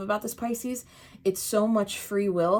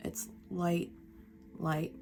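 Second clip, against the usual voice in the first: hushed emphasis; 10 dB quieter, slower than usual. Real, not acted. Soft repeated emphasis.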